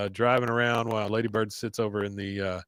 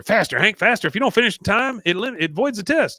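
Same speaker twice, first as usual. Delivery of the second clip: high, loud mock urgency; imitated frantic prodding; urgent coaching; 8 semitones higher, 5 dB louder than usual. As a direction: rush him on against the clock. Voicing Roger urging Hank on.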